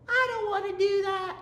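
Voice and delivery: teacher mock-whining about a partial fraction integral; mock whine. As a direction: whine like a kid refusing homework.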